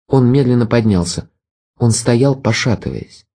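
usual speaking voice

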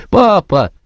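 put-on high crying-out little boy; child's cry. A narrator's reading. Joe crying out to the sergeant in a high child's voice. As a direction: call out for papa.

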